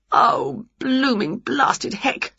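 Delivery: high, slow, drawn-out grumble; exasperated curse. A narrator's reading. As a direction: spell out each cross word slowly. Sun spoils sleep.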